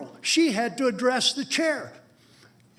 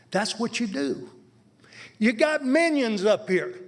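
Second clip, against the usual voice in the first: normal talking voice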